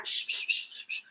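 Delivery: soft breathy shh repeated; shushing the whale to keep it quiet. Retold or imitated. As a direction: hush it like calming an animal. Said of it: "decirle a la ballena".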